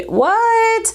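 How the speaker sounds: high, startled what; surprised. Real, not acted. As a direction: gasp it out in disbelief. Shocked at the price.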